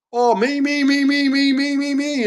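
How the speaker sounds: high sing-song "me, me, me"; mocking self-pity. Mocking those who only talk about themselves, high mimicry.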